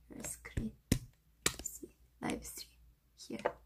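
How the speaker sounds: soft unvoiced whisper. Real, not acted. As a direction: whisper it to the camera. Streamer suddenly whispering to chat.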